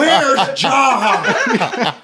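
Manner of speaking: loud, high comic cry; Dave Chappelle skit bit. Acted. Joining friends in quoting the skit, high hammed-up shout. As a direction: call it out frantically, hammed-up. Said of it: "skit where he's like".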